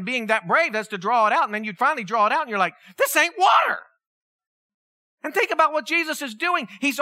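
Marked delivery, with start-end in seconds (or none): 3.0-3.8 sudden high, loud amazement; astonished. Retold or imitated. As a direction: blurt it out in shock. Servant discovers water became wine.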